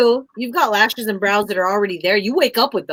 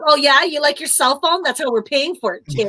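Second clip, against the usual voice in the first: taunting mom; 7 semitones higher than usual. Pretend. Mom taunting her kid.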